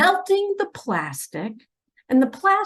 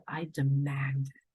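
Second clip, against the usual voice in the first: whispered command; 8 semitones lower, 7 dB quieter than usual. Retold or imitated. Quoting God's demand.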